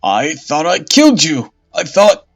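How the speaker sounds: loud, high, stuttering panic; stammering terror. Acted. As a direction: babble in horror. Bishop faces the dead witch.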